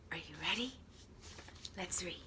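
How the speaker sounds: soft whispered question. Shown as a demonstration. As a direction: lean in and whisper to the kids. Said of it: "whispered question to children".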